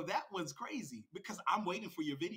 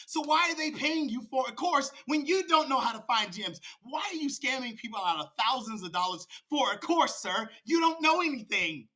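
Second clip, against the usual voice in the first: loud, raised-pitch scolding; angry challenge; 6 semitones higher, 7 dB louder than usual. Real, not acted. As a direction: hammer each accusation at a scammer. Angry rant about the rival's course.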